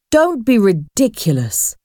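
plain reading voice

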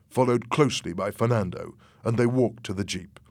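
usual speaking voice

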